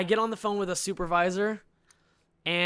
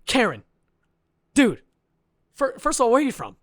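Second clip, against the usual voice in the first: loud, higher exasperated call; burst out; 6 semitones higher, 8 dB louder than usual. Retold or imitated. Caller bursting out at Karen on phone, loud exasperated. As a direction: snap at her, demanding. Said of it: "So I burst out".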